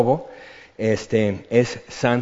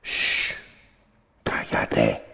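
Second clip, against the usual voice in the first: Jesus calmly silencing the sea in a whisper; whispered hush; 6 dB quieter, much slower than usual. Shown as a demonstration. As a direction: whisper shh, then a low whispered cállate.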